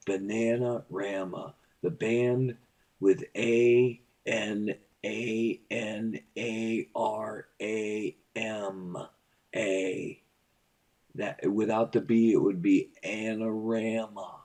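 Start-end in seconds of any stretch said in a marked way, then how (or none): 3.0-10.2 letters spelled out slowly; slow spelling. Shown as a demonstration.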